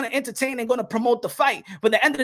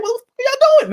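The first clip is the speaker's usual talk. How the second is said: much higher, loud cry of disbelief; exasperated squeal; 13 semitones higher, 4 dB louder, slower than usual. Retold or imitated. Tank Davis fed up with his handlers, high squealed protest. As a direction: yelp it out, fed up.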